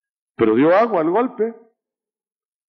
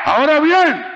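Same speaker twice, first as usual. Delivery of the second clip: long, high, full-force hallelujah; preaching shout; 13 semitones higher, 7 dB louder than usual. Acted. Preacher crying out to the congregation in a drawn, loud shout. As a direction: stretch it out and shout it to the church.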